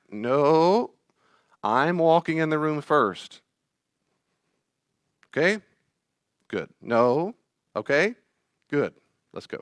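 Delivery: firm lead. Retold or imitated. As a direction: coax the child along. Adult leading firmly.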